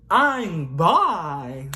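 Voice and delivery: "I'm bi" blurted out loud and high; yelled. Retold or imitated. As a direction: shout "I'm bi" across the room. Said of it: "I just yelled".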